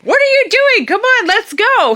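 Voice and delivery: very high, loud mock wife voice; impatient wife. Wife urging her husband back into the water, high impatient call. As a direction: imitate a wife calling out, high-pitched and urging. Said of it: "My wife pops up and she's like".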